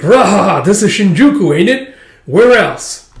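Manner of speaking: high, loud laughing showman; boisterous master. Acted. Master tells the kid where to come, boisterous. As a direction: boom it out with hammy gusto.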